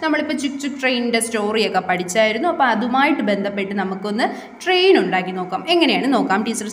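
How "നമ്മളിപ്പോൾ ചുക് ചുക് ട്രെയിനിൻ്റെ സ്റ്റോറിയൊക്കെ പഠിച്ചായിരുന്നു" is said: ordinary delivery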